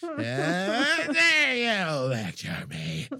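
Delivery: very slow, stretched hammy outrage; over-dramatic. Shown as a demonstration. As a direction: overplay it like bad melodrama. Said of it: "doing everything way over dramatic".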